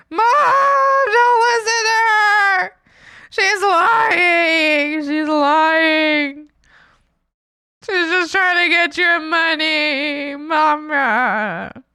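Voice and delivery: high, loud, slow desperate plea; pleading shout. Pretend. Daughter shouting at mom not to trust the saleswoman, pleading. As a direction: beg her mom not to listen.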